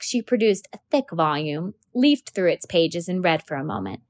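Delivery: usual speaking voice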